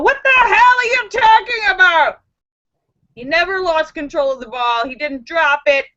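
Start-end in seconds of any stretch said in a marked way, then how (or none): 0.0-2.2 high, loud incredulous outburst; exasperated retort. Real, not acted. Vlogger retorting to commentators who justify the call, loud and exasperated. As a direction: snap back at the call's defenders.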